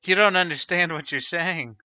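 ordinary talk

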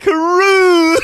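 high, loud, long-held whoop; mock cheer. Three cheers for Yannick, mock cheering shout. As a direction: holler it like a crowd cheer.

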